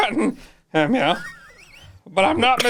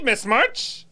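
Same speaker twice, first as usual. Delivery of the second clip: normal talking voice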